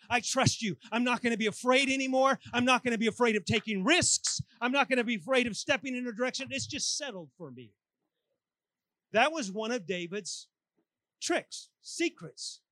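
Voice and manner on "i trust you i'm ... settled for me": louder, firm vow to God; bold declaration. Retold or imitated. Preacher voicing a confident prayer, loud firm declaration. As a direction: declare it with rising resolve. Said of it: "and I say".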